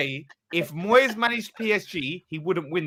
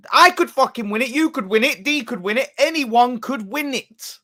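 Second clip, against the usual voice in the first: loud, higher-pitched indignant outburst; exasperated, raised-voice protest; heated retort; 5 semitones higher, 6 dB louder than usual. Real, not acted. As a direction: shout it down, hammering each clause. Heated retort in a football argument.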